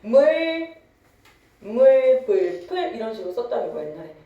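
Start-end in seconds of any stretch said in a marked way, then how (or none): 0.0-2.9 "물, 불, 풀" with unrounded eu, as meul, beul, peul; old unrounded vowel. Shown as a demonstration. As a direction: draw out "물" loudly as old-style 믈. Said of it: "원순모호가 일어났었어".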